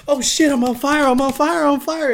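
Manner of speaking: high, loud panicked yelling; yelling in a hammed-up panic, half laughing; mock panic. Driver after the crash yelling he's on fire, mock panic. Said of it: "I'm getting out like".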